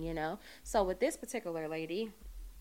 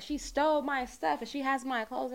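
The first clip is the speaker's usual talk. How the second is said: angry accusing; 6 semitones higher, 4 dB louder than usual. Retold or imitated. Furious client accusing her.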